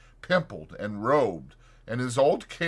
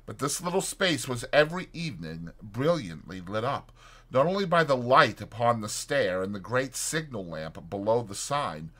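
ordinary delivery, nothing marked

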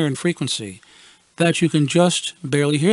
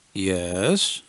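guarded; 6 semitones lower, 4 dB quieter than usual. Retold or imitated. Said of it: "I responded with guarded concern".

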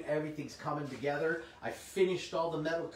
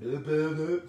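gibberish; 4 dB louder than usual. Real, not acted.

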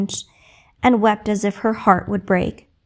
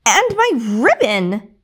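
slow, loud whine; spoiled sister snatching; mild petulant outcry; indignant cry; 3 dB louder, slower than usual. Acted. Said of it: "cried the other".

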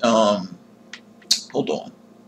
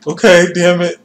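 ordinary talk, nothing marked

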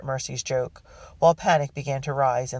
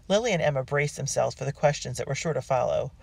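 usual speaking voice

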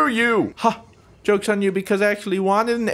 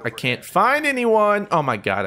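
ordinary talk

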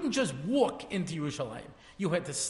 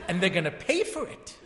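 laughing. Real, not acted. Punchline to the driver.

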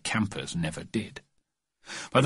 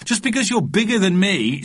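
put-on higher-pitched child's retort; squabbling little boy, pitched up; protesting small boy; 10 semitones higher, 3 dB louder than usual. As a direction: argue back in a raised kid's voice. Boy arguing in a scuffle.

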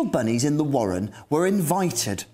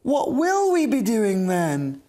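pleading bunnies; 8 semitones higher than usual. Acted.

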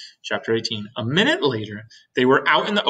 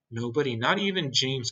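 plain reading voice

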